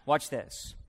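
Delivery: usual speaking voice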